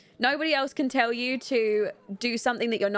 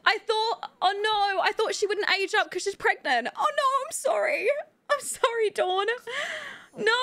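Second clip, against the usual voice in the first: high, whiny mock apology to the game character; squeaky apology; 10 semitones higher than usual. Real, not acted. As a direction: plead sorry in a tiny high voice. Apologizing to Sim Dawn.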